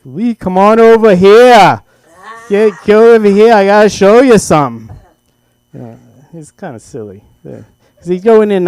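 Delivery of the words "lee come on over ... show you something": booming announcer voice. Retold or imitated. Dad calling his son over in his full broadcaster voice. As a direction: boom it out like a radio host. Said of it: "it was more like".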